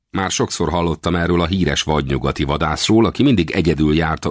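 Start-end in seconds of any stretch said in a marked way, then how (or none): none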